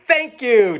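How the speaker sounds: high, bright thanks from a happy dog; dog says thank you. Acted. Dog thanking the bird, high and loud. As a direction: squeak out a grateful doggy thank-you.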